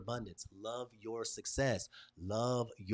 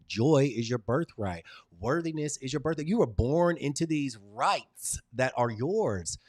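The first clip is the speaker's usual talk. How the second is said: affirmation; 3 semitones higher, 6 dB louder than usual. Acted. Speaker affirming birthrights to the room, steady and preaching. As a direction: preach each right as a gift.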